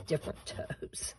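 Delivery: soft, whispered punchline; whispered aside. Real, not acted. Crafter jokes to viewers about toes, whispered. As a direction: drop it to a whisper as a joke.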